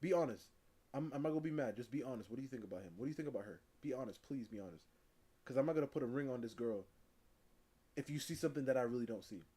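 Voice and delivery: soft, low earnest plea to family; pleading quietly. Retold or imitated. Man asking family about his girlfriend, soft low plea. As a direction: quietly beg them to be honest. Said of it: "Be like".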